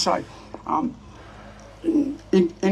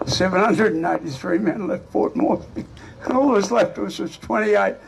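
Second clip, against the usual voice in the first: normal talking voice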